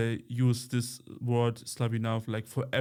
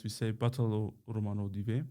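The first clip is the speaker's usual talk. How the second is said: usual speaking voice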